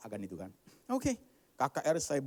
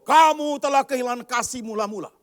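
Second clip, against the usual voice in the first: thundering verdict; 11 semitones higher, 14 dB louder than usual. Acted. Preacher booming God's verdict at the church. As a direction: hammer it like judgment.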